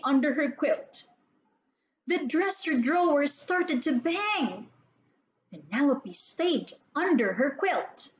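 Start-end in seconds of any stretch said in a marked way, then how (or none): none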